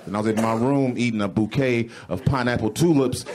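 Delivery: ordinary delivery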